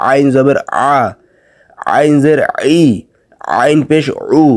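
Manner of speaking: আইন squeezed from inside the throat; throat ain drill. Shown as a demonstration. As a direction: give আ, ই, উ each a pharyngeal ain. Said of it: "আইনের উচ্চারণ গলার ভেতর থেকে".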